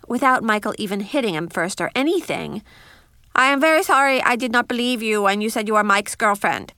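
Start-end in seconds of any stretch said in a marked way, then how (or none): none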